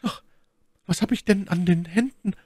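high falsetto lady's question; My Lady, distressed. A narrator's reading. Lady discovering her hurt hands, high and troubled. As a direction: ask in a pained, high-pitched lady voice.

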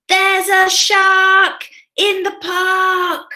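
high, loud, drawn-out storybook shout; loudest voice. Acted. Timothy raises the alarm in the park, loudest voice. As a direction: shout it slowly for children to join in. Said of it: "In his loudest voice".

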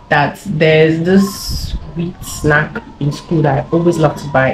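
ordinary delivery

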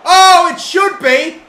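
slow, high, loud wail of frustration; real frustrated yell; anguished shout. Real, not acted. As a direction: scream it at the screen. Chance squandered, shouts out.

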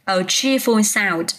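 slow, careful a cheerful sound; teacher models the phrase slowly for the class. Shown as a demonstration. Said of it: "Ví dụ như mình nói là".

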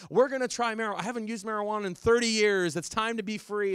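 high, loud mock enthusiasm; hammed-up gleeful empty nester; empty-nester parent. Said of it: "empty nesters ... who are like".

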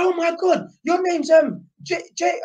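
high-pitched excited Cockney impression; Cockney accent. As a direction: put on a high Cockney geezer voice. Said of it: "that's how I'm doing a Cockney accent".

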